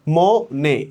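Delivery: ম-, নে split into two beats; two separate syllables. Shown as a demonstration. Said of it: "এভাবে দুইটা অক্ষর ধরা হয়". (ম আর নে আলাদা অক্ষরে)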